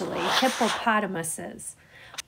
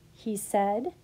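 ordinary delivery, nothing marked

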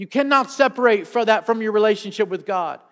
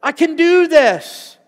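rising, louder, slowed-down burst of confidence; building can-do excitement, slow and loud; confident; 5 semitones higher, 6 dB louder, slower than usual. Retold or imitated. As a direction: say it like you just realized you can. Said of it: "you start getting like".

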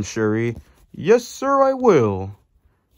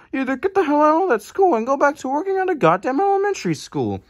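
higher, fast mocking rant; goofy character trashing a rival school, voiced higher; 7 semitones higher than usual. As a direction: rattle it off high and scornful. Goofy rants against the school.

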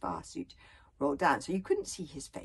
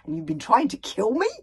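louder, disbelieving question; stunned he was the target all along; astonished; 2 semitones higher, 15 dB louder than usual. A narrator's reading. Hiccup realising his bodyguard tried to kill him, astonished. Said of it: "said Hiccup in astonishment".

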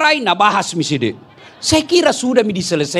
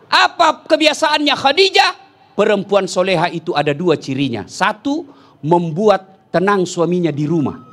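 normal talking voice

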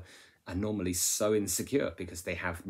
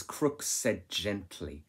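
slow emphatic reading; much slower than usual. Shown as a demonstration. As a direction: read slowly, leaning on gently. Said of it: "First of all, it says".

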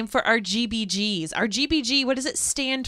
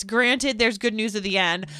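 ordinary talk, nothing marked

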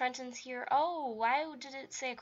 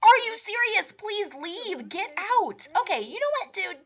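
yelling; 8 semitones higher, 14 dB louder than usual. Acted. Kicking unwanted Sim out.

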